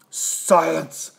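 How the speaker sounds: a curt, commanding bark. A narrator's reading. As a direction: bark the order down the stack. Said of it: "the king of the turtles barked back".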